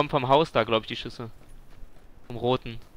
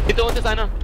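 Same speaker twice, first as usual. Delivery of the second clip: loud, high warning cry; alarmed shout; 8 semitones higher, 9 dB louder than usual. Real, not acted. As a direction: call out the danger. Warns of enemy behind.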